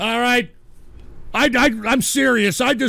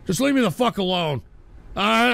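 ordinary talk

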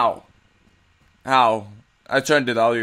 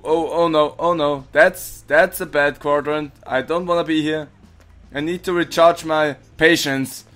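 ordinary talk, nothing marked

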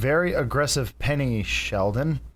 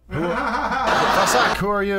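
surprised; 7 semitones higher, 3 dB louder, much slower than usual. Real, not acted. Shocked reaction to picture.

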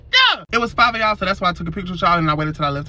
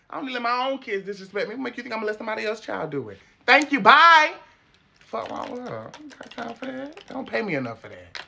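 a no-nonsense ISS teacher scolding students; sassy detention teacher; 3 semitones higher than usual. Acted. In-school suspension teacher scolding students, sassy and tired. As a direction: run detention like you've seen it all.

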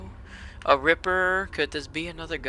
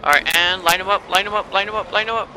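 loud, higher rapid-fire chant; urgent urging; 6 semitones higher, 8 dB louder than usual. Real, not acted. Player lining up shots as enemies close in, urgent. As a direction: raise pitch and volume as the pressure builds.

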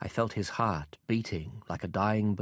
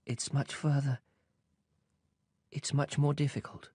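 sadly; 4 semitones higher than usual. A narrator's reading. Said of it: "And then, sadly".

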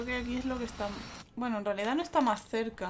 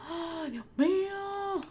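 dramatic groan; 8 semitones higher, much slower than usual. Real, not acted. Frustrated at missing requirement.

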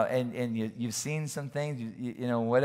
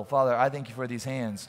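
ordinary talk, nothing marked

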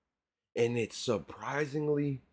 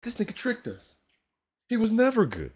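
high outraged disbelief; 10 semitones higher, 6 dB louder than usual. Real, not acted.

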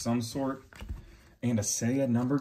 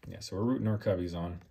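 ordinary talk

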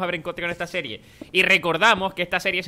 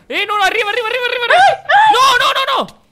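much higher, louder frantic yelling, laughing at the end; panicked shout; 9 dB louder than usual. Real, not acted. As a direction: scream as the spider takes you down. Spider attack, frantic yelling.

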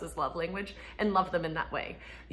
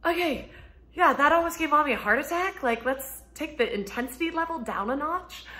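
higher, wry scolding; mom calming kid; 8 semitones higher, 4 dB louder than usual. Retold or imitated. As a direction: ask the kid to tone it down. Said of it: "I was like".

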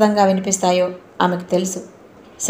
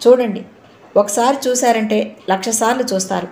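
usual speaking voice

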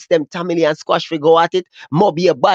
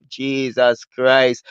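slow low disbelief; 8 semitones lower, much slower than usual. Real, not acted. Shocked exclamation.

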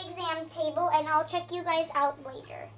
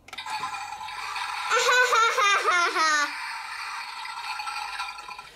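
long open belly laugh, ha ha ha; hearty laugh; 4 semitones higher than usual. Acted. Ken laughing at Barbie's toilet delight.

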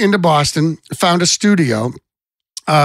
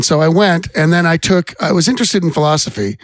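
usual speaking voice

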